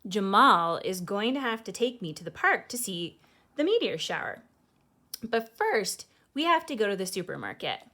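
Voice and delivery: put-on lively read-aloud voice for children; storybook reading. A narrator's reading. Host reading a picture book to kids, lively storytelling. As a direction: read it like bedtime story time.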